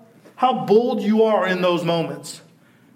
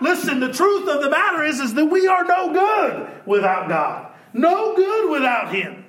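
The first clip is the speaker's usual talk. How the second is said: full preaching; 8 semitones higher than usual. Real, not acted.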